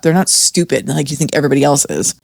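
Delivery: ordinary talk, nothing marked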